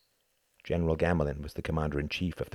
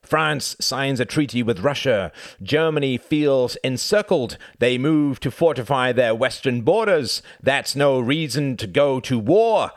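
higher and louder, insistent; heated argument; 9 semitones higher, 9 dB louder than usual. Acted. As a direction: argue it forcefully, slowing down. Cuthbert keeps arguing.

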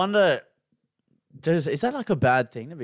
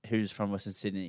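usual speaking voice

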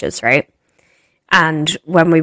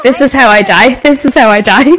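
panicked; 5 semitones higher, 7 dB louder than usual. Retold or imitated. Panicked running after stranger.

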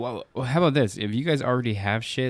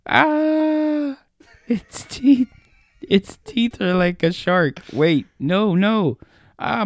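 high, loud pained shrieking; screaming in agony; 10 semitones higher, 6 dB louder, slower than usual. Acted. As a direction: scream through horrible pain. Creature eating her alive.

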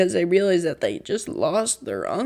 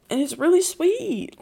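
higher, trembling voice on the edge of tears; voice quaking; 10 semitones higher, 3 dB louder than usual. Real, not acted. Vlogger moved to tears by the finale, voice quaking. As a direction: let the voice shake as the tears come. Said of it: "Like, my voice is quaking".

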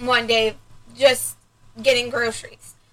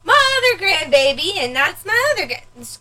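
much higher, proud grandma showing off; mock proud grandma; 9 semitones higher than usual. Daughter imitating her mom proudly introducing grandkids, high. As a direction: play the bragging grandmother.

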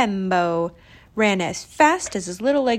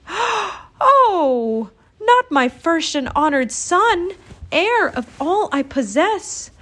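high, wailing dismay; distraught mother; 7 semitones higher, 3 dB louder, slower than usual. A narrator's reading. Mother hears of firstborn's fall, distraught wail. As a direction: wail in alarm for your child. Mother learns son fell in.

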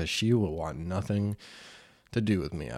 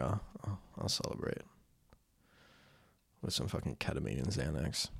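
quiet; 4 semitones lower, 10 dB quieter than usual. Real, not acted. Quiet, lower joke.

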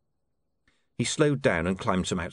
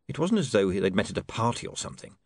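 usual speaking voice

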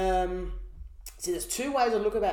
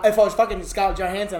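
ordinary talk

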